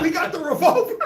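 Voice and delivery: mock voice broken by laughter; laughing quote. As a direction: quote him through laughter. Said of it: "He said".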